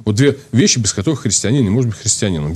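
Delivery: ordinary talk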